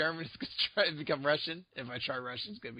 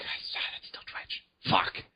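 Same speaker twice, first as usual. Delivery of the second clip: mock whispered dismay; slower than usual. Quoting a dismayed reaction.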